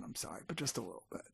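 very soft, low, quick confession; hushed little apology; sheepish aside. Real, not acted. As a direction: admit it under your breath. Sheepish aside admitting a small regret.

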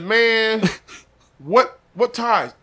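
slow, high, loud disbelief; disbelieving. Retold or imitated. Asking the friend what strings come attached, disbelieving. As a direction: drag it out in disbelief. Disbelieving question about offer.

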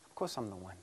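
soft, low, gentle reassurance; hushed loving voice; loving reply. Acted. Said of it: "Jesus responds with love".

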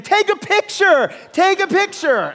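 high, loud eager shout; excited call. Retold or imitated. Pastor running at the bears, shouting eagerly to friends. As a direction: call back thrilled to your friends. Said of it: "turning around saying".

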